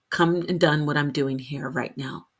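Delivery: ordinary talk, nothing marked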